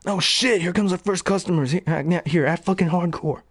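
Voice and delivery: high, fast stoner dude voice; excited slacker yelling to his buddy; Jay impression. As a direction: play the hyped-up Jay from Jay and Silent Bob. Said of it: "they'll be like".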